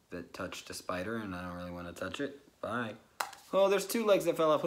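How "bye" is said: ordinary talk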